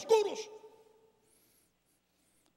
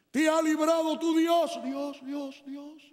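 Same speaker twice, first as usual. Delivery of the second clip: echo; 4 semitones higher than usual. Shown as a demonstration. A preacher acts the king's echo in the den, fading. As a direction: let Dios bounce back and die off. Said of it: "el eco de la misma voz del rey".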